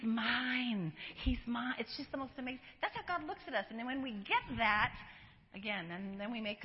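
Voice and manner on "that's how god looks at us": ordinary delivery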